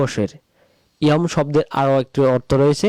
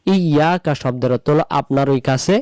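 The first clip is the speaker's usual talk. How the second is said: normal talking voice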